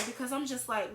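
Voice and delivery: ordinary delivery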